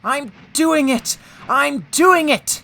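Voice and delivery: high, slow, straining exclamations; panting. A narrator's reading. James climbing the hill, panting with effort. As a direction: strain out each phrase, pitched high. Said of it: "he panted".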